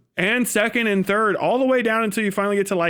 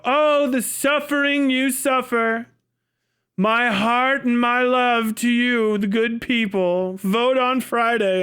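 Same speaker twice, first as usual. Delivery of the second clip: higher, slow, syrupy mock elite; mock elite condescension; 4 semitones higher, slower than usual. Coastal elite waving a doily at the people, mock sweet and slow. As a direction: pity the peasants from a window, slowly and sweetly. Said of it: "wave a doily at you and go".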